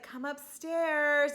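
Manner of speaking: mock seductive call; drawn-out beckoning. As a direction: stretch each word out like a coy summons. Kay beckons her date upstairs.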